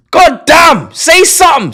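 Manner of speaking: high loud shout, begging; exasperated shout. Real, not acted. Streamer shouts high and loud begging mods to speak. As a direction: yell at the mods to respond.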